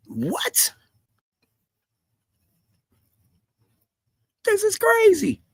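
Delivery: high, breathy stunned question; stunned disbelief. Real, not acted. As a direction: react as if you can't believe it. Stunned reaction to admission.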